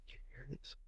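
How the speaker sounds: barely-there whisper with no voice at all; whispered test. Shown as a demonstration. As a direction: breathe the question so only one ear can catch it. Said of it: "I put the microphone".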